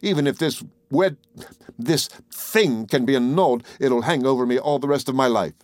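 ordinary delivery

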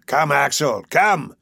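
lightly voiced impatient professor, altered timbre; an understated impatient shout, summoning someone. A narrator's reading. Impatient uncle hurrying his nephew amid the porters, shouting. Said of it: "I found him shouting".

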